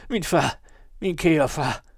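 high, loud cry to father; delirious cry. A narrator's reading. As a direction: call out fervently for father. Dying man raves to his father.

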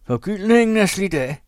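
high, loud scornful jeer; husband jeering at his wife; jeering shout. A narrator's reading. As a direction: shout the insult with contempt. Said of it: "blev han ved".

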